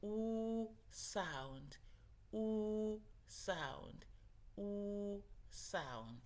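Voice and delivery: oo sound drawn out with round lips; long oo demo. Shown as a demonstration. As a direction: model the oo in oo sound slowly for kids. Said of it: "And make the".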